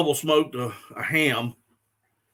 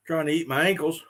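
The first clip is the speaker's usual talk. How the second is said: normal talking voice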